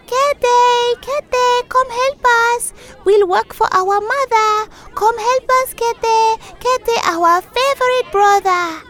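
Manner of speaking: high, loud sing-song call to a brother; children calling from the rooftop. A narrator's reading. Children on the roof calling Kete, high and loud.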